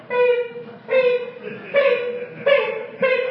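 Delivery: put-on high squeaky child scanner beeps; child's beeping. Little Emily scanning toys with gleeful beeps. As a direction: chirp each beep like an excited little girl. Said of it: "Emily just going through going".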